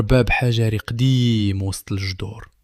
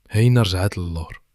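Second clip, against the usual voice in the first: ordinary delivery, nothing marked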